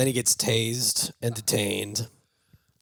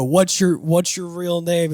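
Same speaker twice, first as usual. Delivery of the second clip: higher, slow, impatient demand for his real name; pushy cop; 7 semitones higher, 6 dB louder, slower than usual. Retold or imitated. Cops pressing Bob for his real name, slow impatient. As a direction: press him, drawing it out.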